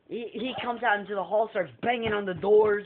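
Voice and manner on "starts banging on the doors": normal talking voice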